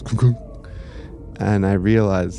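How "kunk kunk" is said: heartbeat thump. Retold or imitated. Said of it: "heart just goes".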